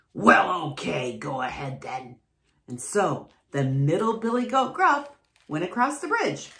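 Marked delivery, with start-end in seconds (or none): 0.1-2.2 troll voice. A narrator's reading. Troll grants passage.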